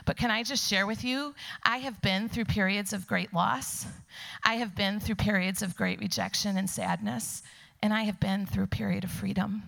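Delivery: ordinary delivery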